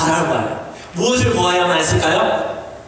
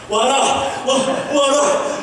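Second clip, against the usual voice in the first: mock-commanding Jesus calling out; shouting call to come; 6 semitones higher, much slower than usual. Shown as a demonstration. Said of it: "아, 이렇게 하고 있을까요?".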